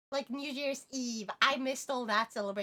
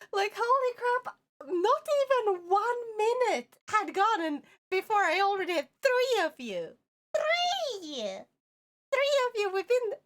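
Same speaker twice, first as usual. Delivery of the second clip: excited; 7 semitones higher, 3 dB louder than usual. Real, not acted. Excited over early viewers.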